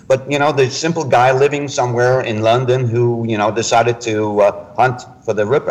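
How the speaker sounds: usual speaking voice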